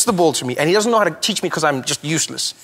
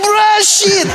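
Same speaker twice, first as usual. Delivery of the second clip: high loud shout; shouted order; 5 dB louder than usual. Retold or imitated. The coach shouts the instruction back at Trevor. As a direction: yell it back at him. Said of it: "He's like".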